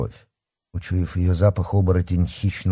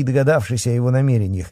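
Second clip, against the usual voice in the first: plain reading voice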